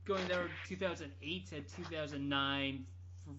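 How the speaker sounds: ordinary talk, nothing marked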